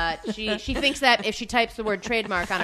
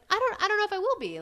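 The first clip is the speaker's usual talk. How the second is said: unsure protest; 9 semitones higher, 4 dB quieter than usual. Retold or imitated. Retells unsure reply to boss.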